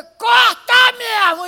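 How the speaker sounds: high, slow shouted demand; false mother demands the baby be cut, shouting. Retold or imitated. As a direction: yell it coldly, cut him in half. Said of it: "que não é mãe".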